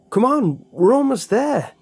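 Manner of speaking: high loud push onward; urging shout. A narrator's reading. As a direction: shout him forward, breathless. Urging while running.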